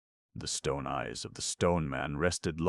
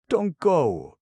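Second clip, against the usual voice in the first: much higher, louder pleading stone man; plead, don't let them leave; begging; 14 semitones higher, 11 dB louder than usual. A narrator's reading. Crunch begging the travellers to stay. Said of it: "begged Crunch".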